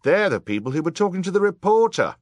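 very high, loud accusation; crying out. A narrator's reading. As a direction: cry it out to the crowd. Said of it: "cried somebody else".

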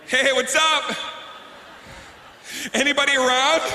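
casual test shout; calling out. Retold or imitated. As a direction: call out to check nobody's home. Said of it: "So you have to yell out".

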